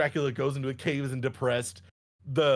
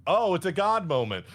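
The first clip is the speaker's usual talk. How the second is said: ordinary delivery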